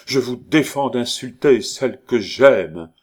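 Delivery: loud, high-flown defence; magnificent indignation. A narrator's reading. Young man defending his beloved to neighbour, grand outcry. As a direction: forbid it grandly, like a stage hero. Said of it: "s'écria Roland avec un geste magnifique".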